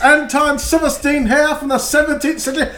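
put-on high posh old-timey critic; cute anime streamer. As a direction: play a pompous seventeenth-century gent. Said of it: "professional comedian critic Antoine Silverstein".

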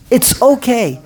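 usual speaking voice

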